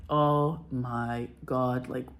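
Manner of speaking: slow, low, drawn-out disbelief; hammed-up stunned bewilderment; mock dumbfounded. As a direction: imitate them reeling, dragged out and low. Acting directors' dumbfounded reaction.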